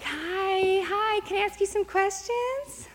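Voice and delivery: high, slow singsong nurse voice; mock-sweet high-pitched imitation of a nurse; chirpy nurse. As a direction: coo it sweetly, drawing out each greeting. Imitates nurse greeting woman in labor.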